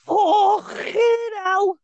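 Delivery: high, exaggerated fake Scouse; hammed-up plastic Scouser; bad Scouse accent. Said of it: "Anyway, that's Scouse now we've ruined".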